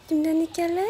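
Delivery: high cooing pet voice. Real, not acted. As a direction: coo to the pet. Parle à l'animal, voix aiguë. (voix pour parler aux animaux)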